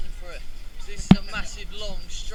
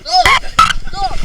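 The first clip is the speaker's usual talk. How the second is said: shrieking high in alarm; 11 dB louder than usual. Real, not acted. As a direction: shriek for it to stop. Alarmed at the slide.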